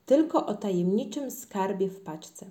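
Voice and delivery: plain reading voice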